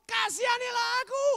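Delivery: loud, high cry for help; acted shouting. Blind beggar calling Jesus as he passes, high shout. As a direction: shout to be heard over a crowd. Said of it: "teriak-teriak".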